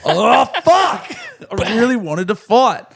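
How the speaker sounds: high, loud mock yell of disappointment; mock frustrated shout. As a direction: ham up the guy who missed the brawl. Mimics the guy who missed the fight.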